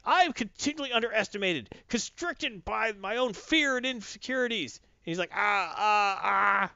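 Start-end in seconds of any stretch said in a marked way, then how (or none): 0.1-4.8 high-pitched retold rant, no real volume; hammed-up grievance speech; mock yell. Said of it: "she starts yelling out stuff like". 5.3-6.7 high put-on cries of "ah"; hammed-up distress sounds; mock pained groans. Brainiac groaning in pain.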